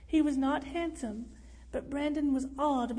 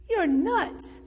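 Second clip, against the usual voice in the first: loud, high exclamation of disbelief; incredulous outburst; 6 semitones higher, 6 dB louder than usual. A narrator's reading. Brandon refusing the burly man's snack, incredulous outburst. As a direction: burst out, appalled at the offer. Brandon rejects offer of grasshopper.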